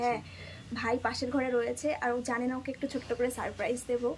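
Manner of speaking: hushed, low voice; whispering on the phone. Real, not acted. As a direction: keep it down so he won't hear. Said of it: "এত আস্তে কথা বলছি".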